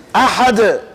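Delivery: "Ahad" recited with a firmly released final d; preserved intonation. Shown as a demonstration. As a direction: recite "Ahad", bouncing lightly off the last consonant. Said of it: "foi preservado até a entonação".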